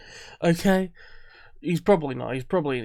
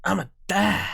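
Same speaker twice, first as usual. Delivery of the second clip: mock Boston accent; 3 semitones higher than usual. Viewer mimicking the dad from the show, mock Boston accent. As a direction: imitate a Boston accent, drawn out. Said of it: "like he had a Boston accent".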